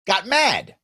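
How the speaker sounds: ordinary talk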